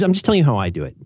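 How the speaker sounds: ordinary talk, nothing marked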